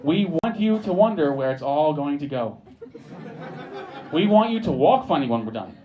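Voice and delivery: ordinary delivery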